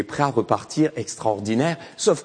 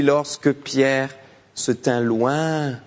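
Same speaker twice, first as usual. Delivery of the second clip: usual speaking voice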